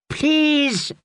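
high, pleading please with a quaver; whimpering plea. A narrator's reading. Wounded Dick begging the jester not to strike. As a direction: beg for mercy in pain. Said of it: "Dick whimpered".

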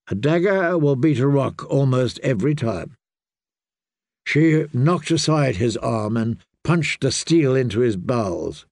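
ordinary delivery, nothing marked

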